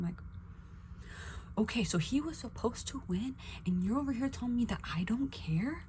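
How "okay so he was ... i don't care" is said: high, incredulous retort; retold indignant comeback. Retelling her comeback to being told she doesn't care, incredulous. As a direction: act out disbelief, pitched up.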